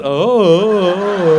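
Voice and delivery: drawn-out, vacant "oh"; spaced-out son. Retold or imitated. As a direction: mimic a boy who notices nothing. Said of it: "Again, my son just".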